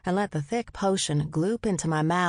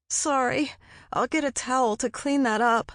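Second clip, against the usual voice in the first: higher, strained through the teeth; gritted through nausea; 6 semitones higher than usual. A narrator's reading. Nauseous heroine to boyfriend, gritted and strained. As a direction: force words out while sick. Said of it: "I gritted".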